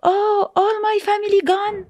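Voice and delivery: high, wailing cry of loss; anguished high lament, not shouted; retold screaming. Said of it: "all of them screaming".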